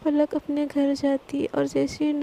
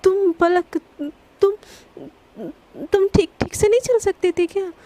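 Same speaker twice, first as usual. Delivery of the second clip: stammering, rattled and a bit louder; flustered stammer, higher pitched; 4 semitones higher, 4 dB louder than usual. A narrator's reading. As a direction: caught off guard, blurting out. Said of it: "घबरा जाती है".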